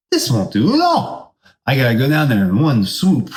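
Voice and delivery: very high, odd character voice; cartoonish high eagle; high put-on eagle voice. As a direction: squeak it out as the old eagle. Old eagle voiced in a story.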